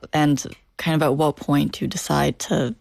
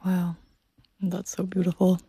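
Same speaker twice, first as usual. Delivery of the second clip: hushed wow; 3 semitones higher, 4 dB quieter than usual. Real, not acted. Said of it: "moved by the story".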